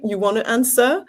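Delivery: ordinary talk, nothing marked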